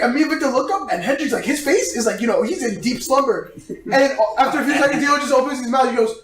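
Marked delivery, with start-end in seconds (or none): none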